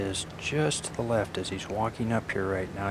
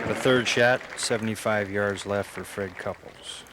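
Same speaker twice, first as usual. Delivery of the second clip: louder live golf play-by-play; golf commentator; 4 dB louder than usual. Real, not acted. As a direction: call the shots like a broadcaster.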